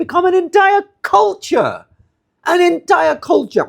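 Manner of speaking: real high-pitched exclamation of outrage; indignant outburst. Real, not acted. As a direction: cry it out in indignation, pitch leaping up. Indignant outburst denouncing hypocrisy.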